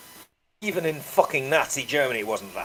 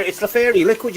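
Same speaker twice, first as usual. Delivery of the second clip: playful punchline; 7 semitones higher, 6 dB louder than usual. Real, not acted. Joke punchline, fairy.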